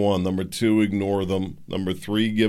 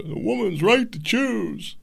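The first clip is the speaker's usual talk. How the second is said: mocking opponent; 12 semitones higher, slower than usual. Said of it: "you'd say".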